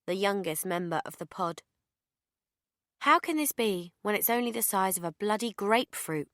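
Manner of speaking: ordinary delivery, nothing marked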